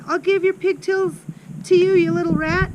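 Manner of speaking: high, loud threatening yell. A narrator's reading. Headmistress swings Amanda by pigtails, yelling. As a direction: yell it at the child while swinging her. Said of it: "Miss Trunchbull was yelling".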